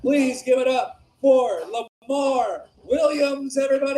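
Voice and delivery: high, rousing stage introduction; announcer intro. Real, not acted. Host bringing up the next comedian, announcer shout. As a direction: call out the name, drawing it out.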